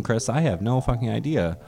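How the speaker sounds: ordinary talk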